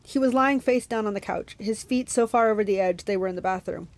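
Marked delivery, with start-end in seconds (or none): none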